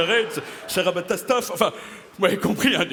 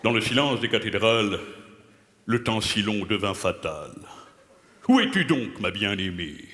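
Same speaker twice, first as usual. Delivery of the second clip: grand, lower poem recital; mock declamation; 7 semitones lower than usual. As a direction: declaim his own verse like a tragedian. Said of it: "que je vais déclamer devant vous".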